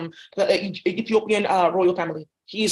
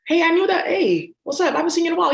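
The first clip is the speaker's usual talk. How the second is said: higher, louder gushing hello; excited greeting; 7 semitones higher, 4 dB louder than usual. Acted. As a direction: greet an old friend eagerly. Acted chatty VR greeting.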